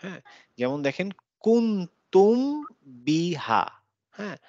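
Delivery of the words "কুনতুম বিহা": কুনতুম বিহা slowly, with the মিম hidden in a nasal hum before বিহা; ikhfa with ghunna. Shown as a demonstration. Teacher showing students the ikhfa rule, slow nasal hum. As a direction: stretch কুনতুম, let the মিম hum in the nose, then a clear বিহা. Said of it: "গুন্না দিয়ে উচ্চারণ করব".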